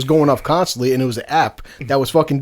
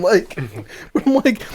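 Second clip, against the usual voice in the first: laughing slowly; 3 semitones higher, 4 dB quieter, much slower than usual. Real, not acted. Laughing at the memory.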